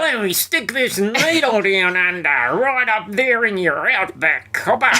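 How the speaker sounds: high, far-off hammed-up Aussie doctor; mock broad Australian. Doctor voiced in broad Aussie accent.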